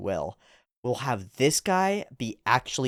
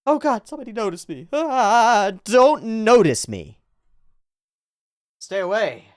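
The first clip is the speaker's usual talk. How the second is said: panicked character; 10 semitones higher, 6 dB louder than usual. Acted. Commentator voicing the rapper who fears being noticed, panicked. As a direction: voice his dread in a high mock panic.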